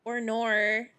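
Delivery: playful Australian "oh no"; teasing put-on Australian accent; mock Australian accent. Shown as a demonstration. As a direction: exaggerate the Australian vowels into "or nor". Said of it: "I am an honorary Australian".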